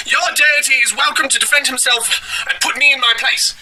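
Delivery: high-pitched mock-zealot shout; Yirmeyahu Weeps. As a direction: challenge rudely in a caricatured high cry. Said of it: "how rude he was being".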